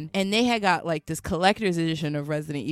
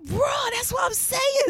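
much higher, loud excited agreement; excited shout; 4 dB louder than usual. Real, not acted. Agreeing with her friend, shouting in delight. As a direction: shout it out in glee.

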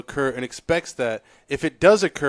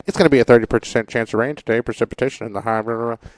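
loud, fast broadcast forecast voice; weatherman voice; 3 semitones lower, 5 dB louder, faster than usual. Retold or imitated. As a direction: rattle it off like a TV weatherman. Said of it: "Is that your pilot voice?".

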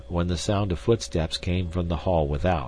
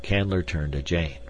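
plain reading voice

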